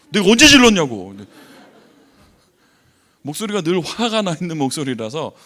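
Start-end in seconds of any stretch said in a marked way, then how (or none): 0.1-1.1 high, loud mock protest; retold indignant shout. Husband denies to his wife that he ever yelled, shouting indignantly. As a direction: yell the denial, acting out the argument. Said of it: "지금도 소리 지른다면서".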